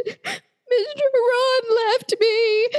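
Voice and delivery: put-on high, slow, crying little girl voice; child sobbing to her mama; imitating a sobbing child. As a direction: wail it like a heartbroken preschooler. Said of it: "she is sobbing".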